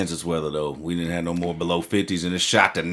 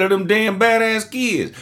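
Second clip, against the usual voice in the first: exasperated retort; 12 semitones higher, 3 dB louder than usual. Retold or imitated. Retold exasperated answer.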